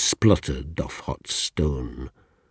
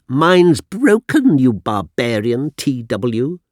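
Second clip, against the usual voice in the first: mocking, much higher and louder plummy teacher; posh teacher; 9 semitones higher, 9 dB louder than usual. Schoolmaster mocking his pupil, plummy and superior. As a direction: sneer it like a snobbish schoolmaster. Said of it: "He was nicely spoken".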